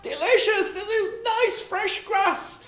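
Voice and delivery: very high, slow, delighted sheep voice. Acted. Sheep finding fresh grass, very high and slow delight. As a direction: savour it like a squeaky little sheep. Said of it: "he said to himself".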